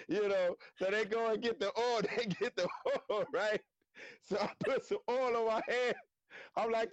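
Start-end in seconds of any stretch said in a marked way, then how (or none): none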